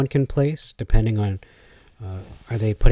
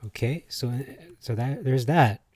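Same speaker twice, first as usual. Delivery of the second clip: ordinary talk, nothing marked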